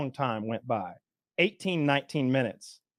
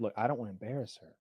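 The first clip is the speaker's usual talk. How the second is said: quiet, low and discreet; lowered voice; 5 semitones lower, 9 dB quieter, much faster than usual. Retold or imitated. Diner discreetly telling a manager about the waitress, lowered voice. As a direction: keep it down, confidential and quick. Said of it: "I lowered my voice".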